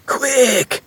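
high, sharp call to hurry; rally friends urgently; urgent yell. A narrator's reading. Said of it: "yelled Fox".

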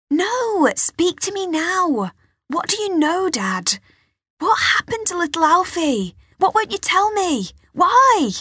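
high, loud pleading demand; upset demand. Acted. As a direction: push him for answers, voice high. Girl confronts father about brother.

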